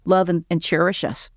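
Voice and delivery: ordinary delivery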